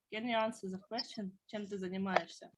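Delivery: ordinary delivery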